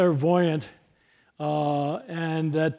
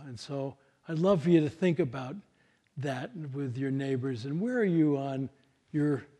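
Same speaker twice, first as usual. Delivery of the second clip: ordinary talk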